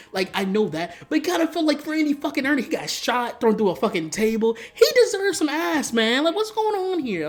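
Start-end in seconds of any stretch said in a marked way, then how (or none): 1.1-7.3 high-pitched, exasperated comic plea; indignant protest. Real, not acted. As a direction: argue his case, pitch climbing in disbelief.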